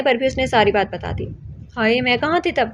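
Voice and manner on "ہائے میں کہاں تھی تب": ordinary delivery, nothing marked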